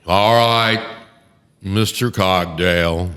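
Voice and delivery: imposing judge addressing counsel, slow and resonant; patrician judge voice. Retold or imitated. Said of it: "he talks like this".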